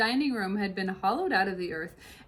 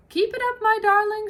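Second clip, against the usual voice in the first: high, louder encouragement while running; father fox urges his son on; cheerful shout; 13 semitones higher, 9 dB louder than usual. Acted. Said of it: "shouted Mister Fox".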